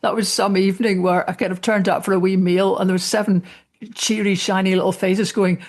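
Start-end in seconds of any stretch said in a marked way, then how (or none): none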